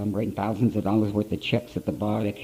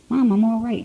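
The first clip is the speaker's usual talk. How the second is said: higher, defensive reassurance; insisting; 11 semitones higher, 4 dB louder than usual. Retold or imitated. Drunk son tells his weeping mother he is fine, insisting. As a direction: brush off her worry.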